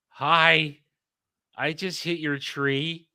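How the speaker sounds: high, slow, meek little voice; sheepish plea. Retold or imitated. Acts out asking church for help.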